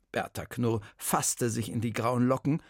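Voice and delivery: usual speaking voice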